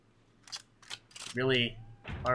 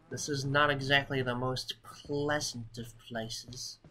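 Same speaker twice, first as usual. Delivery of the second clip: ordinary delivery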